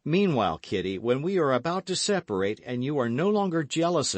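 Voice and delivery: loud, high gallant hero; gallant young man. A narrator's reading. D'Artagnan takes leave of Kitty.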